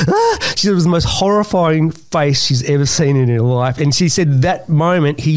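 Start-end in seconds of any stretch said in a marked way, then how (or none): 0.1-0.5 sudden high frightened ah; mock horrified cry; startled gasp. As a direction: gasp as if the face just appeared. Startled woman's scream retold.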